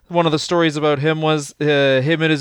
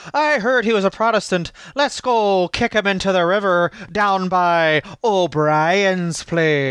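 put-on comic Irish accent; 3 semitones higher than usual. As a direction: play along in a mock Irish accent. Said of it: "What spot-on accents".

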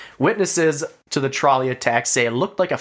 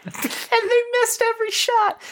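laughing. Real, not acted. Incredulous laughing at missed shots.

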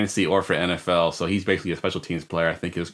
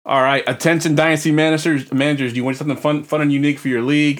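higher, louder promo announcer; punchy, raised-voice sales read; 6 semitones higher, 4 dB louder than usual. Acted. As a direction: pitch it to the league managers like an ad. Host reads a promotion.

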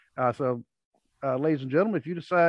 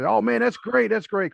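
mocking excited brag; 8 semitones higher, 6 dB louder than usual. Mocking the idiot's bragging.

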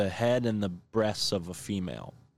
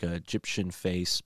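ordinary talk, nothing marked

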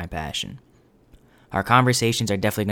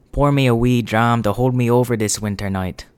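louder, hammed-up bad Scottish brogue; terrible Scottish accent; 3 semitones higher, 5 dB louder than usual. Shown as a demonstration. Podcast host shows how Scots order whiskey, terrible Scottish accent. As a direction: put on a poor Scottish impression. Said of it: "that is a terrible Scottish impression".

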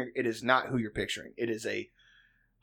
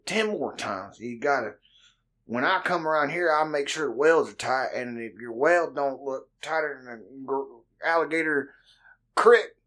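Cajun supervisor imitation; 8 semitones higher than usual. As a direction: ham up a thick Cajun boss laying down the law. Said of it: "And he'd be like".

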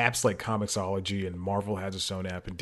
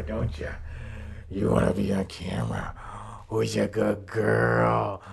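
talking to a dog. Real, not acted. Coaxing the dog onto camera.

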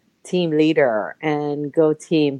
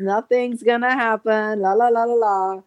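higher, mock-whiny refusal; stubborn dismissal; 5 semitones higher than usual. Refusing her friend's help, mockingly whiny. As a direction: brush it all off with la la la. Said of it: "I kept saying to her".

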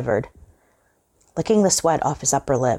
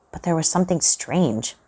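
usual speaking voice

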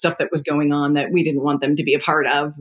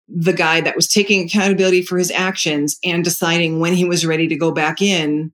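usual speaking voice

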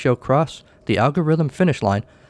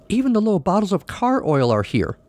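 excited, higher voice near a squeal; almost squealing; 8 semitones higher than usual. A narrator's reading. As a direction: nearly squeal with delight over the find. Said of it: "almost squealing".

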